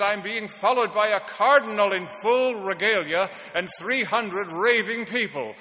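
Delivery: acted-out panic, loud and high, slowing at the end; retold frightened alarm. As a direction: sound the alarm like a frightened rabbit. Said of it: "like a frightened rabbit".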